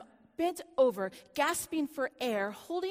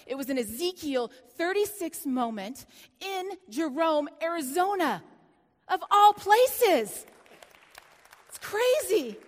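higher, louder, stretched out; excited exclamation; 4 semitones higher, 7 dB louder than usual. Real, not acted. Preacher telling congregation of her Jerome moment, rising excited. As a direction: build to a joyful peak.

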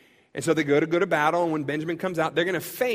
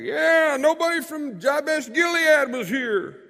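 much higher, louder call from far off; yell from the back; 10 semitones higher, 4 dB louder, slower than usual. Retold or imitated. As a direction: shout it across the crowd. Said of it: "Someone else yells from the back".

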